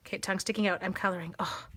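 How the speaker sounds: normal talking voice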